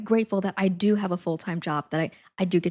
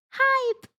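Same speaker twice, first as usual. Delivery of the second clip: very high squeaky "Hype"; high-pitched. Pretend. Streamer offering viewers a second take, high-pitched. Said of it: "It was definitely the high-pitched one".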